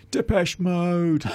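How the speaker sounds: high, drawn-out mock shout; imitated heckler's yell. Man in the crowd heckling the band, imitated yell. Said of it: "was yelling out".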